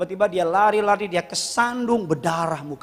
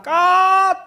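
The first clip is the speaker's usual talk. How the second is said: mock shout; 12 semitones higher, 6 dB louder than usual. God shouting cut at sinner.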